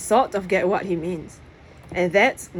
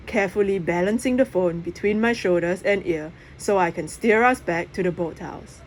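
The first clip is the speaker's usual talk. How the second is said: usual speaking voice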